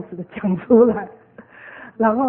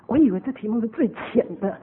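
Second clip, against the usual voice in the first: normal talking voice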